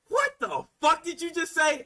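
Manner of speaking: high-pitched incredulous demand; stunned disbelief. Acted. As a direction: react as if you can't believe your ears. Acted stunned reaction to the call.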